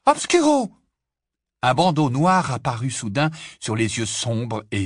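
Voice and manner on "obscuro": high, loud spell cast; cried spell. A narrator's reading. Hermione casting at the portrait, loud cry. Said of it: "Hermione s'écria aussitôt".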